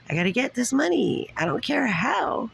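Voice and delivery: high, insistent; excited. Real, not acted. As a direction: push it up high, determined. Insisting on getting money.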